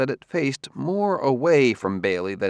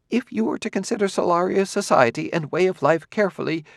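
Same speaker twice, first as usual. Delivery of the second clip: raised, quickening, eager bursts; a hint of a scholar's sudden eagerness; jerky enthusiasm; 10 semitones higher than usual. A narrator's reading. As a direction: rush ahead in fits of excitement. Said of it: "A kind of jerky enthusiasm entered Quemot's voice".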